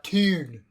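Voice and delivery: "Toon" with a long oo vowel; "Toon" drawn on one rounded oo; Geordie pronunciation. Shown as a demonstration. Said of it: "'Cause they're like".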